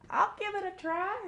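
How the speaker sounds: very high, soft small-animal voice; small timid plea from a tiny creature; squeaky muskrat offering to try; tiny little voice. Acted. Muskrat's small voice speaks again.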